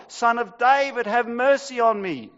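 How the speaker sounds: high, loud desperate cry. Acted. Blind beggar crying out over the crowd, loud. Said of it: "he shouted all the more".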